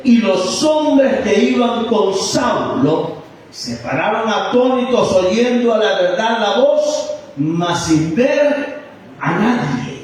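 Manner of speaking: measured Bible reading, lifted and drawn out; scripture reading. Acted. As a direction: read the verse out slowly to the congregation. Reads the Bible passage aloud.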